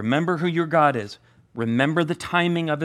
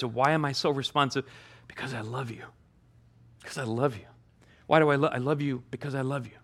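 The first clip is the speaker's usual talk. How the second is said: gentle divine reassurance; tender Savior voice; 2 semitones lower, 4 dB quieter, faster than usual. Acted. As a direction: speak it softly, like a loving whisper to a child. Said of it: "hearing that from the Savior himself".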